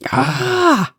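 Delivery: high, loud frustrated cry of ah; sharp shout of frustration; frustrated yell. Acted. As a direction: let out a fed-up shout. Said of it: "Izzy yelled".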